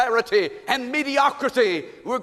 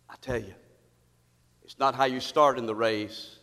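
much lower, much softer sincere plea; hushed earnest preaching; 10 semitones lower, 7 dB quieter than usual. Acted. Preacher quietly warning the church, hushed and sincere. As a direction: bring it down to a hush.

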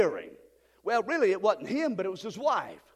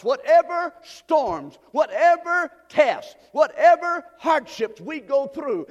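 preaching at full force; 2 semitones higher, 5 dB louder than usual. Acted. Pastor rousing the church about storms, in loud preaching. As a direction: lift the room with every whatever.

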